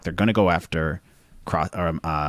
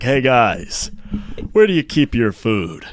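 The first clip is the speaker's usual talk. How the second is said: loud, drawn-out celebrity impression; big put-on voice hailing the crew; Patrick Warburton; 4 semitones higher, 6 dB louder, slower than usual. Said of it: "And he's like".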